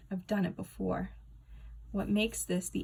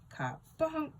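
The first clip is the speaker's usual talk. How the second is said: kabunk; 8 semitones higher than usual. A narrator's reading. Comic sound effect.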